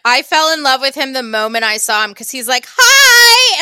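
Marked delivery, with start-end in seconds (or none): 2.7-3.6 much higher, loud bubbly "Hi!"; excited greeting. Retold or imitated. Clawhauser greeting Judy at the front desk, excited. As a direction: burst out a giddy hello like the cheery cop.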